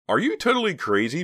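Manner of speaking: high, loud outraged protest. A narrator's reading. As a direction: protest hotly. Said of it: "protested Les".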